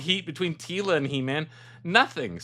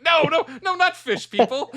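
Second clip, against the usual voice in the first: high excited denial; 10 semitones higher, 4 dB louder than usual. Retold or imitated. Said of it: "And he's like".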